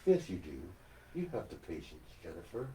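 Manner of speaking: low, soft painting-host impression; Bob Ross. Mock host encouraging Jennifer about her patience, low soothing impression. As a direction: drop the pitch and reassure gently. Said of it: "I gotta work on that voice".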